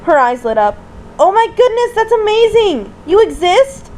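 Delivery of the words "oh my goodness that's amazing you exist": high-pitched, thrilled disbelief; excited, awestruck squeal of delight; amazed. Acted. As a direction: gush in wonder at meeting a legend. Astonished character meets the Easter Bunny.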